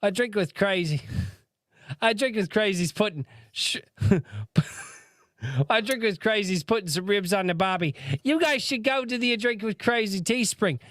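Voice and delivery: high, badly faked Australian-Cockney; Australian accent slipping into guttersnipe English. Shown as a demonstration. Host practises the goat mascot's merch plug, botched Australian accent. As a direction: attempt Australian and drift into gutter-snipe English. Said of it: "turns into a gutter snipe fucking English accent".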